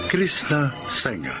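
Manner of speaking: usual speaking voice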